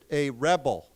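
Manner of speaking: "rebel" as a noun, REB-el; teacher's model of "rebel" with the first syllable stressed. Shown as a demonstration. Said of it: "the accent is different".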